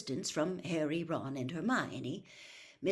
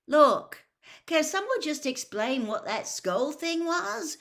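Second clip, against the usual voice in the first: higher, louder impatient outburst; 11 semitones higher, 8 dB louder than usual. A narrator's reading. Ron fed up with the argument, impatient. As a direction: cut through the bickering impatiently. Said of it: "said Ron impatiently".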